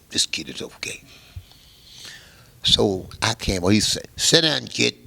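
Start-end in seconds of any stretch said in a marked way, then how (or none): 0.1-1.0 whispered aside. Retold or imitated. Repeated, voice dropped away. 4.2-4.9 put-on loud, higher order to sit down, kid; gruff order. Mob boss to young musician, loud gruff order.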